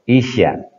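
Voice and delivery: Isya, the sy sound clearly voiced; correct pronunciation. Shown as a demonstration. Teacher repeating the right form for the class: Isya with sya. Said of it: "bukan Isa ya".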